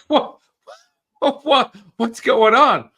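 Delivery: high, loud startled cries of what; shocked. Retold or imitated. Student shocked by the heat he channeled, shouting. His own shocked reaction retold.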